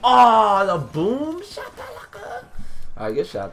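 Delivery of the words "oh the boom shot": high-pitched gamer reaction; startled outcry. Real, not acted. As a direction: yell out high in sudden shock. Reacting to boom shot in game.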